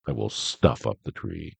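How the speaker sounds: grinning Grinch. A narrator's reading. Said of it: "grinned the Grinch".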